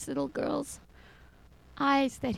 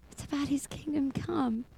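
whispered, reverent; 4 semitones higher, 6 dB quieter than usual. Real, not acted. Speaker to listeners, hushed reverent whisper. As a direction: breathe it out as a hushed truth.

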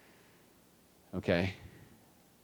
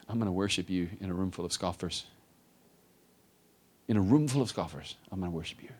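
soft vow; 2 semitones lower, 6 dB quieter than usual. Acted. The devoted heart, quoted.

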